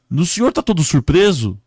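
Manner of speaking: high, loud inquiry; rescuer questioning. Acted. Man questioning a crash victim, loud and high. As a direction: call out the obvious question. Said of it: "o homem chegou pra ele e falou".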